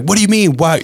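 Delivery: high, indignant "what do you mean"; mock-defensive husband; defensive retort. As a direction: snap back, offended. Defensive reply to wife.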